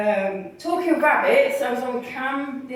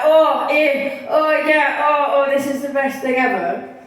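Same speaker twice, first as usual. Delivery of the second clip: louder, exaggerated show of delight; mock enthusiasm; 4 semitones higher, 4 dB louder than usual. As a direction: gush as if thrilled by it all. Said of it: "I'm normally like".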